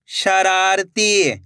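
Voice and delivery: slow, stretched reading of the whole word; joined-up word. Shown as a demonstration. Teacher showing learners the finished word, slowly joined up. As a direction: blend the syllables into one drawn-out word. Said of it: "तो मिलके बन जाएगा".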